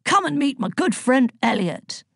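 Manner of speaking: jovial godly welcome; hearty introduction. A narrator's reading. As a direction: introduce a friend with gusto. Zeus proudly introduces friend to daughter.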